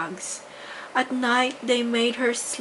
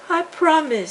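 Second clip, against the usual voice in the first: eager promise; 8 semitones higher, 5 dB louder than usual. A narrator's reading.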